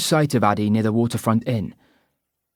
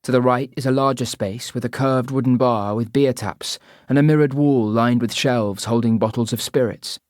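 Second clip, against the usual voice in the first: usual speaking voice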